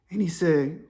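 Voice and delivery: usual speaking voice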